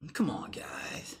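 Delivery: soft unvoiced slow whisper; tired whisper to chat. Real, not acted. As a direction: breathe it as a weary aside. Aside to chat.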